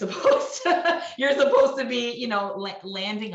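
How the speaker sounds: high, loud burst of real laughter in the words; laughing. Real, not acted. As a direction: let a laugh break into the phrase. Laughs at the contradictory stereotypes.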